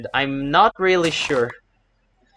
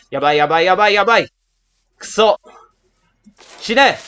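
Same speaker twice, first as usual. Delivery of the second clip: high, loud frantic yabai; panicked Japanese cries; 8 semitones higher, 8 dB louder, faster than usual. Real, not acted. Mid-battle panic, high loud Japanese cries. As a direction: cry out in alarm, Japanese words.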